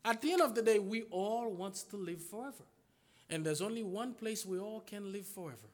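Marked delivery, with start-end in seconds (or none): 0.8-2.7 softer repeat. Shown as a demonstration. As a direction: drop the repeat softer, stress on all. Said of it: "repeated line, softer take".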